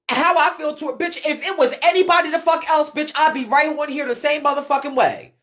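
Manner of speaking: usual speaking voice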